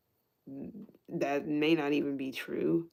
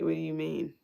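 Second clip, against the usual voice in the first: normal talking voice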